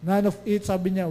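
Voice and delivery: usual speaking voice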